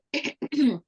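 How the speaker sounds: a formal throat clear. Retold or imitated. Dad clearing his throat before answering the phone. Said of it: "clear his throat".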